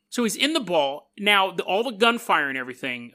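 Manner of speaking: usual speaking voice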